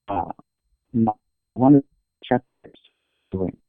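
choppy, distorted phone line; words dropping out over the phone; bad connection. Real, not acted. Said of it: "Oh, no, I'm losing ya".

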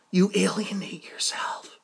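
whisper. Real, not acted. Repeating cousin's words to himself.

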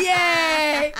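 high happy cheer; excited cheer. Real, not acted. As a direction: cheer it out loud. Cheering the dog.

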